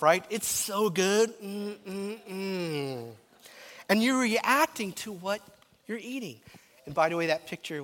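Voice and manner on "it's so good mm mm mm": savoring self-talk. Acted. Preacher acting out talking to himself over barbecue, savoring. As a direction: relish it to yourself, slowly. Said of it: "Now, you're talking to yourself".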